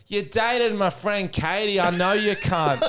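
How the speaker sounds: high, slow call across a room; retold heckler call. Woman exposes the man's act, high slow call. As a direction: draw it out, pitched high. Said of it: "He goes".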